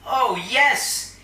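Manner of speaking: hammed-up sarcastic delight; mock enthusiasm. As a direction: fake cheer to mock them. Mocking the villain's logic.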